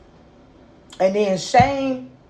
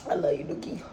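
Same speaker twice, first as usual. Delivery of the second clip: tender; 8 semitones lower, 9 dB quieter than usual. Real, not acted. Mother speaking tenderly to child.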